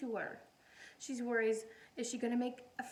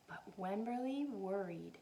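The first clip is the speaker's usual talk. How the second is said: plain reading voice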